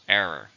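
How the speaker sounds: "Error" stressed on ER at the start; stress on first syllable. Shown as a demonstration. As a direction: say "Error" with the first syllable strong, falling after. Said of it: "Stress on the first".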